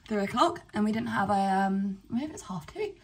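usual speaking voice

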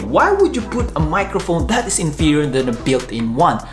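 usual speaking voice

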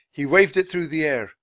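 usual speaking voice

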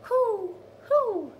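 high hooting owl call; high, hooted owl sound. Acted. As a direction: hoot like an owl for the children. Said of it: "An owl says".

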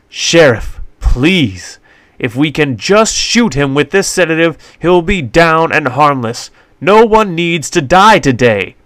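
urgent British pleading. A narrator's reading. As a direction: beg for the man's life. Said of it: "Doc pleaded".

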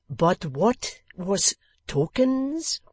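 put-on French accent. A narrator's reading. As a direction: ask in broken English, puzzled. French guest puzzled.

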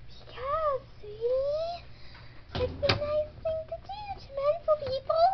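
high, sing-song sweetie voice; very high, softish motherly patience; sugary explaining mom. Acted. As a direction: placate a child in a high-pitched coo. Mother placates child about salesman.

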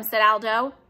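ordinary delivery

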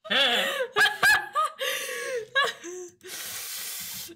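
high-pitched helpless laughter; high laughing fit. Real, not acted. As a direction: cackle until breathless. Long laughter.

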